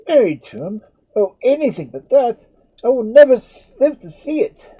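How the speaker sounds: high, loud, despairing outcry; cry of agony. Acted. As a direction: wail it through clenched teeth. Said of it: "cried Edmund, gnashing his teeth in agony".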